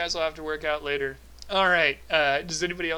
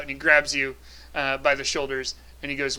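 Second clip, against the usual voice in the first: normal talking voice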